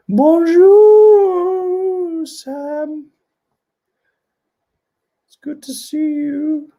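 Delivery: very high, drawn-out bonjour; high falsetto greeting. Pretend. Host greeting viewer Sam, high playful falsetto. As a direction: sing out the hello way up high.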